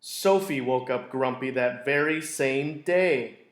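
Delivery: plain reading voice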